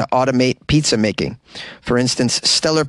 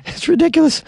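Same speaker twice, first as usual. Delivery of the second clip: outraged; 14 semitones higher than usual. Real, not acted. Ranting about robot pizza.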